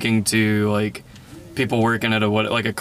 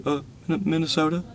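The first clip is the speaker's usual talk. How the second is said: small, halting, questioning voice; mock-nervous clerk, hesitant and hushed; imitated nervousness; 5 semitones higher, 5 dB quieter than usual. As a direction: answer timidly, softly stumbling into a question. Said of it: "they're, like, so nervous".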